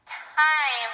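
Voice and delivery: "Time" with a clear closed-lip M at the end; model word. Shown as a demonstration. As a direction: say "Time" finishing on M. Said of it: "words that have M at the end".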